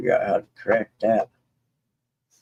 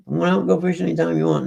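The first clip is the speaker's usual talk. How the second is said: higher, fast mock of grumpy Sonny; quoting moody Sonny; 8 semitones higher, faster than usual. Mocking the moody property owner, higher and fast. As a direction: rattle off his grumpy line in a higher voice. Moody friend quoted, one day.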